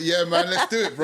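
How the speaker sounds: high laughing hype; pumped-up laughing agreement; excited. Real, not acted.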